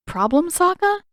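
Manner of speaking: put-on girl snapping at brother; sharp challenge. Katara scowling challenge.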